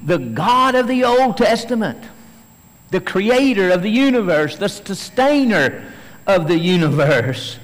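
loud, high, fervent preaching climb; preaching at full force. Acted. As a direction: proclaim it to the back row. Preacher building to a peak.

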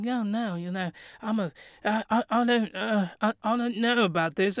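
soft, halting, unsure; timid and hesitant. Retold or imitated. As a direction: stammer it out nervously. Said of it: "she's very trepidatious".

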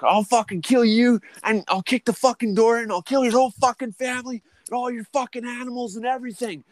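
very high, strained threat; retold gunpoint demand. Gunman demanding his wages from the boss, very high strained. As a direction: shriek the demand like you're losing it.

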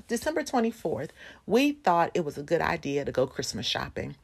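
ordinary delivery

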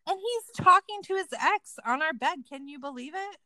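high, loud mock indignation; mock complaining. Complaining about him and his ex, high mock outrage. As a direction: complain in scandalized disbelief. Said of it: "I would be complaining about".

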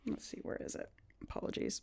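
unvoiced murmur while searching her notes; hushed, under the breath; whispered to herself. Real, not acted. Game master whispers while flipping through notes, barely voiced.

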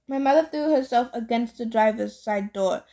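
ordinary delivery, nothing marked

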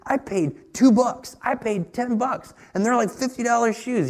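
imitating excited son. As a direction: retell the boy's thrill over cheap Nikes. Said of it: "He was so excited".

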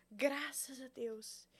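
slow whispered aside; whispering. Real, not acted. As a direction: whisper it under your breath. Aside about the tattoo artist.

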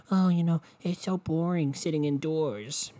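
mock the bored complainers, drawn out; mocking whiner. Mocking people who find indoors boring, in a whiny voice. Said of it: "I'm not one of these fucking".

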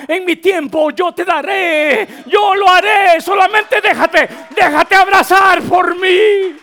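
loud, high preached promise; impassioned shout. Acted. Preacher voicing the Lord's promise to him, impassioned shout. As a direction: build to a full-voiced plea.

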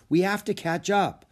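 put-on high boyish voice. A narrator's reading. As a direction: voice Jack as a young boy. Jack voiced in story.

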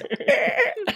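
high squealing laugh; dolphin clicks. Real, not acted. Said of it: "I sound like a dying horse".

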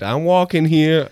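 high, drawn-out catchphrase; catchphrase imitation. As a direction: stretch it out as a mock holler. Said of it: "every time we've said".

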